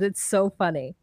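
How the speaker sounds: ordinary talk, nothing marked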